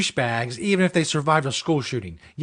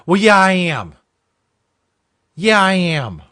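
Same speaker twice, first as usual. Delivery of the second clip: louder, higher, owning it proudly; defiant loud admission; 4 semitones higher, 7 dB louder, slower than usual. Real, not acted. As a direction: throw it back at the critic. Answering imagined objection defiantly.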